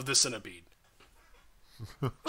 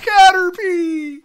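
very high, loud, slow cry; mock wailing; 11 dB louder, much slower than usual. Player crying out for a slain Caterpie, mock wail. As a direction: scream the name in hammed-up grief.

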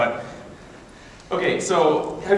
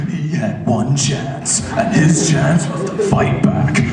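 slow, weighty trailer narrator; mock-epic film promo; movie trailer voice; 3 semitones lower, slower than usual. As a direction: draw out each phrase dramatically. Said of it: "anything with that voice".

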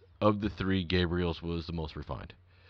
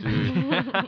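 laughing; 11 semitones higher, 3 dB louder than usual. Real, not acted. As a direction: squeal of laughter. Laughing retelling.

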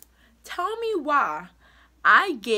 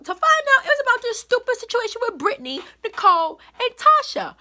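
high, loud, fast words on the edge of crying; upset, near tears; 12 semitones higher, 6 dB louder, faster than usual. Real, not acted. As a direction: let the outrage crack into tears. Upset retelling the blocked name.

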